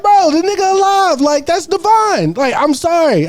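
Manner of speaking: high-pitched protest; pleading defence. Retold or imitated. Retells own pleading defence.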